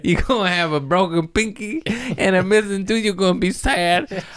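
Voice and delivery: put-on high, babying taunt; mock pity. Mockingly babying someone over injury.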